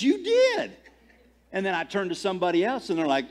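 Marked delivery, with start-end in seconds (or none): 0.0-0.7 high, louder mock-accusing comeback; retold "yes you did" contradiction; insisting retort. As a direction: insist on it, pitch jumping way up. Tech guy insisting back in retold quarrel.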